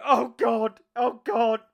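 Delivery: loud, high acted dismay; hammed-up alarmed exclamation; mock horror. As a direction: cry out as a pretend shocked onlooker. Acted casualty reacting in horror.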